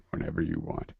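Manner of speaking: soft unvoiced whisper. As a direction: breathe it out in a whisper.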